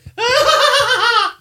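very high, loud maniac cackle; wild ghost laugh. Pretend. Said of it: "you just hear".

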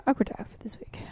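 whispered title; whispering. Real, not acted.

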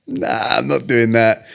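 loud, drawn-out scoffing no; retold emphatic brush-off; dismissive refusal. Retelling his refusal of the course.

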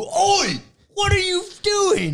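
very high, loud New Zealand "Oi!"; New Zealand shout. Retold or imitated. Friend retells the manager yelling, in a loud high New Zealand accent. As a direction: yell it like an angry Kiwi barman. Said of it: "that's very New Zealand".

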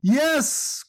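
high, bright exclaimed yes; glad cry. A narrator's reading. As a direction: burst out with joy. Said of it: "cried Claus in answer".

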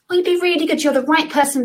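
sweet-talking someone into the job; mock persuasion. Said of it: "that persuasion".

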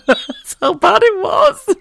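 high squeal through real laughter; helpless laughter. Real, not acted. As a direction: barely get the words out, laughing. Breaks up laughing at own story.

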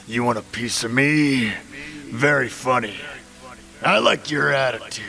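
loud sneering villain. Acted. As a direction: sneer at the brave kid. Villain taunting kid.

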